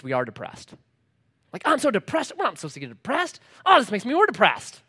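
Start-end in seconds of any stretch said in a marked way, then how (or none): 1.6-4.8 high-pitched parody of moping; mock self-pity. Preacher mimicking someone depressed about being depressed, mock self-pity. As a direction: wail it lightly, sending up the moper.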